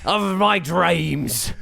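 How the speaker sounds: put-on high, drawn-out old sailor voice; hammed-up old sailor, very slow; old peg-legged sailor. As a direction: draw it out like an ancient sea dog. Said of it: "eighty-year-old sailor with a peg leg".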